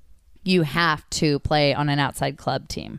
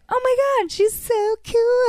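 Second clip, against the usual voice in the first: starstruck squeal. Retold or imitated. Teen gushing over the coach's beer. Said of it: "And I was like".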